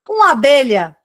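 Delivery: a lightly acted excited call of discovery; excited shout. A narrator's reading. Teacher reading a doctor's excited cry to her class, lightly acted. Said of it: "gritou o médico, todo excitado".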